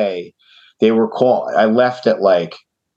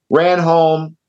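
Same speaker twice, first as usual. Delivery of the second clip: ordinary delivery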